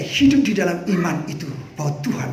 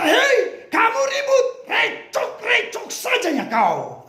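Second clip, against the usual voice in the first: scolding; slower than usual. Acted. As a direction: tell him off sharply, slowing on the last line. Disciples scolding the blind man.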